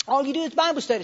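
high, loud mock reproach; scoffing friend.

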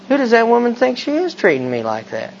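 taking up the grievance as your own; indignant echo. Retold or imitated. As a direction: agree with the inner voice, wounded. Speaker echoes the tempter, indignant.

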